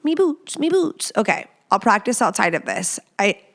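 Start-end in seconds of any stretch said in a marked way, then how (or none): 0.0-1.1 high, playful stab at Irish; mock Irish lilt; attempted Irish accent. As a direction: try a hammed-up Irish accent, pitched up. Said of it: "How do you do Irish?".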